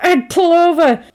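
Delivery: imitating an exasperated shouted order; insistent repeat. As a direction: call out urgently to someone ignoring you. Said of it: "ignoring him, and he's like".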